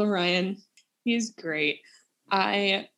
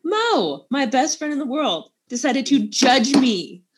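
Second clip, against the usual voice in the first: mock indignant; 5 semitones higher, 5 dB louder, slower than usual. Mock outrage at friend.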